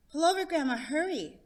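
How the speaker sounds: put-on high, loud and urgent like a little boy; a kid begging grandma to stop the car; urgent child voice. Child Johnny urging grandma.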